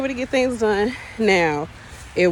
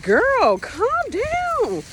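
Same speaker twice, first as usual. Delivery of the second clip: high, exasperated mock plea; high sassy eye-roll retort; sassy calm down; 13 semitones higher, much slower than usual. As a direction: say it way up like you're done with her. Telling frazzled nurse to calm.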